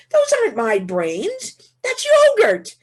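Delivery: drawn out, then leaping high and loud; loud, squeaky-high punchline exclamation; incredulous protest. Retold or imitated. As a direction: act out setting him straight in high-pitched disbelief. Acting out her protest to driver.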